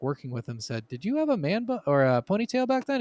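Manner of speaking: ordinary delivery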